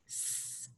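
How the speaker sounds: "S" as a voiceless sustained "sss"; isolated S sound. Shown as a demonstration. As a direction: make only the sound of "S", not its name. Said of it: "It also makes that S sound".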